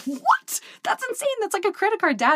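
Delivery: very high, shocked squeal; shocked disbelief. Retold or imitated. Jenny to her dad about twenty percent rates, shocked and high. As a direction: gasp it out in total disbelief.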